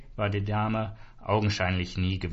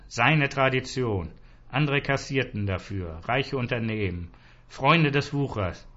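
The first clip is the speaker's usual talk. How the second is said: ordinary delivery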